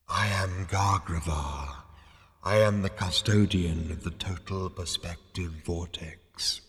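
slow, solemn spectral announcement; deep ethereal voice. A narrator's reading. Disembodied custodian introducing himself to Zaphod, deep and ethereal. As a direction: draw each word out like a disembodied spirit. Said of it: "a deep ethereal voice".

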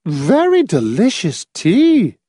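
put-on high, slow and gushing old lady; exclaiming. A narrator's reading. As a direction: Mrs Pritchett praises the tea, slow and gushing. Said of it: "Missus Pritchett exclaimed".

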